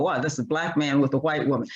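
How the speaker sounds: normal talking voice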